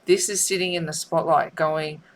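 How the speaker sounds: normal talking voice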